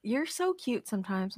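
quiet mock-sweet condescension; condescending. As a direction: imitate a husband gently talking down. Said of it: "Stop being condescending".

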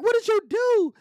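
high, loud scold of a woman; scolding professor. Retold or imitated. Professor confronting her bleeding student, high and scolding. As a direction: go up high and let her tear into him. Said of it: "She like".

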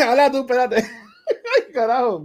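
coughing through a laugh; laughing while choking; choking laughter. Real, not acted. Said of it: "me dio en la parte de atrás de la garganta".

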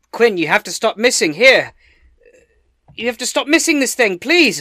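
loud, high in-character pleading; desperate shouted urging to an ally; exasperated plea. Acted. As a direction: yell encouragement in exasperation, ending high. I'm gonna say.

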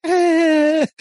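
high laughing shrug sound; a loud amused meh; dismissive eh. Retold or imitated. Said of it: "Ari's still like".

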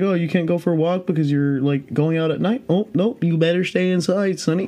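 high, condescending nanny-like put-on voice; sing-song mock warning, pitched well up; mock scolding elder. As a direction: mimic someone patronizing a youngster. Mimics a scolding, patronizing authority.

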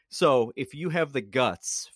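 ordinary talk, nothing marked

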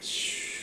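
drawn-out shh of a fall; whooshing noise. Retold or imitated. Jumping off the cliff in a dream, whooshing noise. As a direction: imitate air rushing past in a drop.

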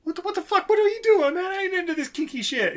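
high, panicky yelping protest; panicked protest. Retold or imitated. Rabbit handcuffed to the heater, high panicky protest. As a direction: squeal it out, trapped and freaking out. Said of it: "and is like".

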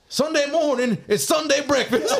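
high, sing-out hype impression; mock celebrity holler, way up high; imitated celebrity. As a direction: crow it out high, mimicking an over-excited celebrity. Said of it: "He's like".

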